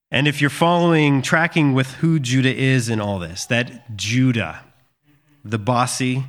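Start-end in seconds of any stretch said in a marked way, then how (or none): none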